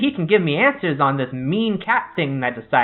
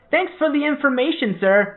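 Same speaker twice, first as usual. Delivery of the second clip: bright, high parting call; cheery call-out; 12 semitones higher, 3 dB louder than usual. Acted. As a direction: toss it back cheerfully over the shoulder.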